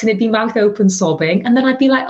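normal talking voice

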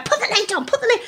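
begging the stranger to leash the dog; frantic plea. Retold or imitated. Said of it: "I'm going".

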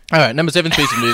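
hurried, laughing, mouth still full. Real, not acted. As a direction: rush it while swallowing food. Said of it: "I can still hear you swallowing".

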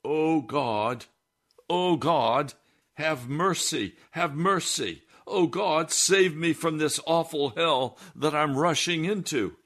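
a frightened man begging God for mercy; desperate outburst. Retold or imitated. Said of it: "he burst out".

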